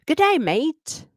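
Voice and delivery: hammed-up Australian nature-show voice; Australian wildlife host. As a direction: play it like an excited Australian crocodile hunter. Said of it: "And I'd say".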